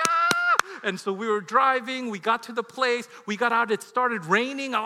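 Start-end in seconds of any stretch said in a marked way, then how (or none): 0.1-0.5 high, loud acted scream; exasperated scream. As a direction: let out a frantic mock scream.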